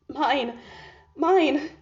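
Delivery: cling to the word, overcome; tearful rapture. Acted. Overcome by her tears.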